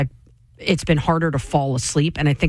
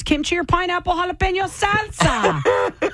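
high, bright commercial read; 10 semitones higher than usual. Acted. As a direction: read it like a TV ad announcer. Reads the product blurb aloud.

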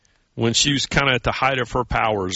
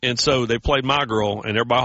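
ordinary delivery

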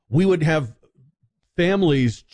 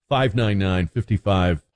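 usual speaking voice

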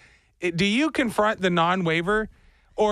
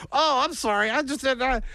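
lightly higher apologetic coworker; switched-up voice; 6 semitones higher than usual. Retold or imitated. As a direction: mimic his excuse, a touch higher. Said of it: "switched up his voice".

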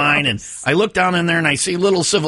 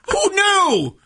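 high, slow sarcastic disbelief; mock astonishment; 7 semitones higher, slower than usual. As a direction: ham up the shocked discovery. Mock shock at obvious tip.

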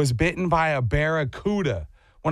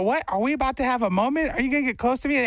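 much higher, a coy question to a horse; voicing yourself meeting a friendly horse, coy; mock flirtatious; 7 semitones higher, faster than usual. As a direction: play it sweet and naive. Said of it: "And then you go".

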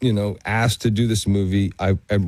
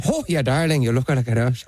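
mock Irish accent, played for laughs; hammed-up Irish accent; bad Irish accent; 3 semitones higher than usual. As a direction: imitate a co-star's clumsy attempt at Irish. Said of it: "he wants to do an Irish accent".

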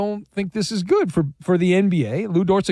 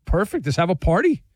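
sarcastic; 8 semitones lower than usual. Real, not acted. Mock celebration of bad trade.

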